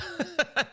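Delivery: a quick real chuckle, high; short amused laugh. Real, not acted. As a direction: laugh briefly at the joke. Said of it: "laughs at the bar militia".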